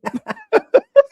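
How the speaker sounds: big burst of laughter; loud laugh. Real, not acted.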